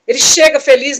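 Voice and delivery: ordinary talk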